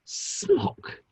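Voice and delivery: scandalised whisper. Acted. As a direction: whisper it back as if it's scandalous. Repeating the risqué word discreetly.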